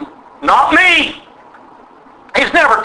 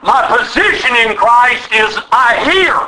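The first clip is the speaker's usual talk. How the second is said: raised, rhythmic preaching to the congregation; 5 semitones higher than usual. Acted. Pastor preaching to his church with raised fervor.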